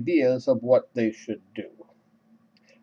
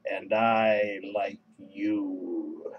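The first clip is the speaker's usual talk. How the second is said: soft, low, very drawn-out swoon; smitten inner thought; 5 semitones lower, 7 dB quieter, much slower than usual. Retold or imitated. Narrator looking at Maria and thinking, smitten inner thought. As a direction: stretch every word low and dreamy. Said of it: "I'm looking at Maria and I'm thinking".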